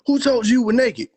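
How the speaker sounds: loud, high accusing question; God as an angry dad, loud and high; stern God demand. Acted. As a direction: bark it like an angry father. God voiced, angry father.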